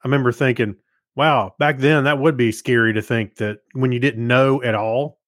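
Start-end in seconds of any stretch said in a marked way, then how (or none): none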